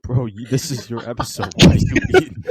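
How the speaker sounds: loud incredulous call-out; shouting. Real, not acted. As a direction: yell at him for eating. Calls out friend eating on mic.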